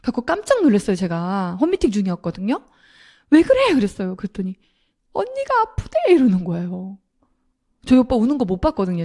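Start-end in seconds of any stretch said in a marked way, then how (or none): 3.3-3.7 much higher, louder startled "what's wrong?"; jolted into asking what happened; sudden worried cry down the phone; alarmed question. Retold or imitated. Startled by brother's crying call. 5.1-6.0 much higher, choked mimic of a sobbing man saying "언니가 아프대"; retelling a brother's sobbing words in a wavering high voice; imitating crying brother. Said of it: "오빠가 펑펑 우는 거예요".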